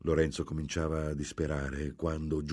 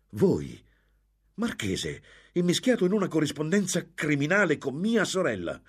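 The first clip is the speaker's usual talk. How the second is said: high, loud outrage; indignant accusation; 11 semitones higher, 6 dB louder than usual. A narrator's reading. Lorenzo to the marquis about his sister, indignant. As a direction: accuse him, climbing high.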